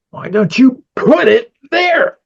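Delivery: animated director's demo, high and emphatic; lively demo read. Shown as a demonstration. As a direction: give it big personality and modulation. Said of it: "You know, like that".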